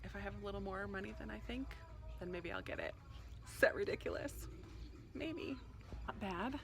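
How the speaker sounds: hushed whisper in a store. Real, not acted. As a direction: keep it quiet, barely voiced. Hushed talk inside the bookstore.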